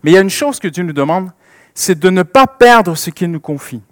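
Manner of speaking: ordinary talk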